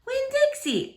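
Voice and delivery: surprised call. Acted. Girl calls dog at night.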